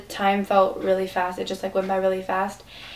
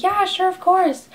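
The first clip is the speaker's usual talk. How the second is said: much higher, drawn-out, eager yes; agreeable imitation; 11 semitones higher, 5 dB louder, slower than usual. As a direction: imitate someone happily agreeing, high and slow. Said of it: "And he's like".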